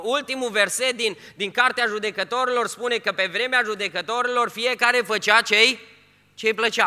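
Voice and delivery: loud, high, fervent sermon voice; preaching at full force. Acted. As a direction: thunder it from the pulpit. (predică în forță, tare și înalt)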